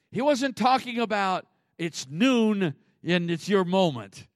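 ordinary talk

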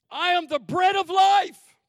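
high, loud proclamation; shouted scripture. Acted. Preacher proclaiming scripture to church, shouted high. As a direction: proclaim it to the rafters.

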